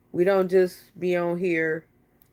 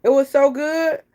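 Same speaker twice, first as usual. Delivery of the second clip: higher and louder, gushing about "good"; excited praise; 6 semitones higher, 6 dB louder than usual. Real, not acted. To her viewers about the movie, excited praise.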